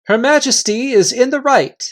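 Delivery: Reepicheep. Acted. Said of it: "said Reepicheep".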